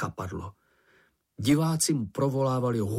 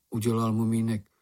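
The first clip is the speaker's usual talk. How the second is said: ordinary delivery, nothing marked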